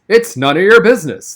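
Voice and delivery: loud, sharp cut-off from the teacher; a stern teacher cutting in, loud and sharp; sharp interruption. A narrator's reading. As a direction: snap it out to shut the kid down. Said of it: "the teacher interrupted sharply".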